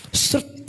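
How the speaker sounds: voiceless swish; quick slide noise. Retold or imitated. Preacher shows believer vanishing fast, whispered swish. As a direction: imitate a fast slip in one short unvoiced burst.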